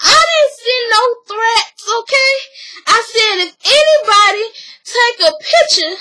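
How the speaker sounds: mock whiny excuse; whiny defense. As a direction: plead innocence like a put-upon mama. [name] defends herself whining.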